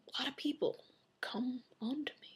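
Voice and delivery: soft, whispered aside; hushed confession. Pretend. Vlogger confiding about admirers, in a whisper.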